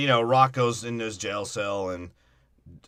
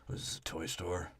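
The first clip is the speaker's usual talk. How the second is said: deep mumble; 7 semitones lower, 16 dB quieter than usual. Retold or imitated. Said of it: "he's like".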